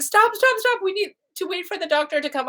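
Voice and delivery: high, fast urgent stop from the nurse. Retold or imitated. Nurse urging the mother to wait for the doctor, high and fast. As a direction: hurry her to hold off.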